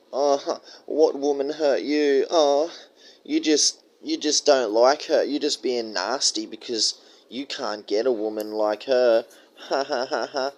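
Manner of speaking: lightly voiced sneering gaslighter; mock critic. Mocking women who call him an incel, mild sneer. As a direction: sneer it like a smug scold, fake laughs. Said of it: "make out like".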